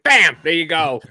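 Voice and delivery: loud, punchy "Bam" like plating with a flourish; mock showy flourish. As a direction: call it out like a show-off cook, playfully. Mimes tossing garnish on a plate.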